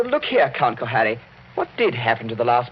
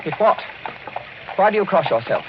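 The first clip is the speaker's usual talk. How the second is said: alarmed question; 8 semitones higher than usual. Acted. Passenger presses the coachman, sharp and alarmed. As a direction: demand an answer, startled.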